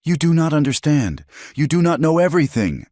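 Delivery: high, fast, desperate insistence; a woman near breaking, pitched high; voice rising. A narrator's reading. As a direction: push it out, rising and raw. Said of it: "her voice rising".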